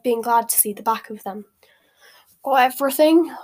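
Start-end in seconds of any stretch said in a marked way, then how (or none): 2.4-3.3 loud, cheery question; hearty, higher-pitched greeting; bright. A narrator's reading. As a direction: ask brightly, voice lifted. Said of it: "asked Harry Hagrid brightly".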